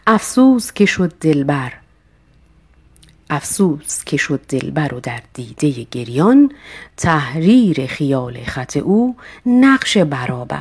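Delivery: ordinary delivery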